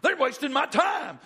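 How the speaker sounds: loud, high exasperated ranting; angry rant. Acted. Acted rant about twenty-five items.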